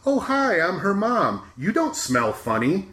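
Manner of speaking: high, sing-song greeting from an overeager mother; chirpy mom voice. Retold or imitated. Mother introducing herself to her daughter's coworkers, high and gushing. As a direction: pitch it up and gush like an embarrassing mom. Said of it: "throughout the trailer, it's".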